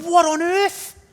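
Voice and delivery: high, loud disbelieving exclamation; exasperated "what on earth?"; baffled outcry. Acted. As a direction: blurt it out, stunned and indignant. Voicing reader's shock at verse.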